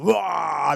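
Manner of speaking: loud drawn-out roar; imitated lion roar. Showing what lions sound like, imitated roar. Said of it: "They're roaring".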